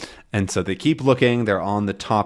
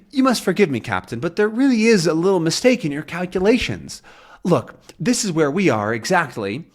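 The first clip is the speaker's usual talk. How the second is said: high, fussy detective voice; high Thompson voice; 7 semitones higher than usual. Acted. Thompson questions Captain's calculations, high and fussy. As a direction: pitch it up like a prim detective.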